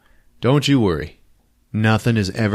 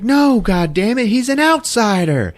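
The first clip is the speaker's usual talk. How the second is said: much higher and louder, protesting; indignant queen; 13 semitones higher, 3 dB louder than usual. Retold or imitated. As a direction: protest shrilly that he's an outsider. Queen objecting to the outsider.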